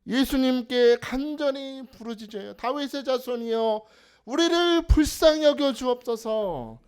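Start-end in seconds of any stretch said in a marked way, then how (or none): 2.5-6.8 loud, slow cry for mercy; shouted plea. Retold or imitated. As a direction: call out slowly, pleading hard. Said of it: "소리질러 외쳤더니". (소리질러 외치는 간구)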